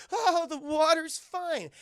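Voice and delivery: high, loud, slow put-on voice; freezing kid. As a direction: fake that the icy water is fine.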